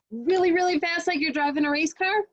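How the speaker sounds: fast like a race car. Shown as a demonstration. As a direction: race through it, speeding. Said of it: "how fast you read".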